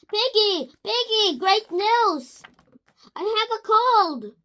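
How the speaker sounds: high, loud and eager; hammed-up delighted announcement; excited news. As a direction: burst in with the good news. Gerald happily tells Piggy.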